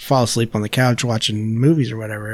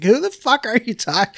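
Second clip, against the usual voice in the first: much higher, incredulous question; puzzled wife calls out to him; baffled wife; 11 semitones higher than usual. Retold or imitated. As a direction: ask like she can't believe it. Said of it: "she's like".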